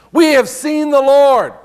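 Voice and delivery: eager witness announcement. Retold or imitated. Disciples telling Thomas they saw Jesus, eagerly. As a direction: call it out with wonder.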